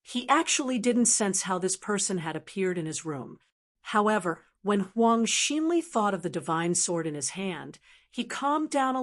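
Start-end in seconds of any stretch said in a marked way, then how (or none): none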